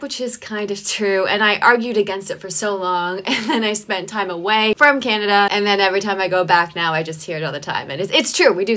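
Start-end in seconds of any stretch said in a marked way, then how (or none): none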